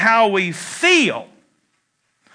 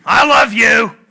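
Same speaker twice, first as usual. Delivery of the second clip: loud declaration; 6 dB louder than usual. Shown as a demonstration. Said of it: "put together to be constructive".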